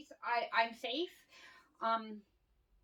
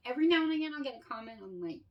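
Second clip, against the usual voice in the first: normal talking voice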